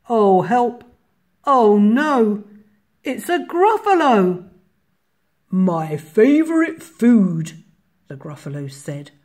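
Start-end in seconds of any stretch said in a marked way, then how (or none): 0.1-4.4 loud, high cry of alarm; frightened mouse. A narrator's reading. Mouse meets monster in story. 5.5-7.6 slow, loud monster voice; menacing Gruffalo. A narrator's reading. Monster menaces mouse in story.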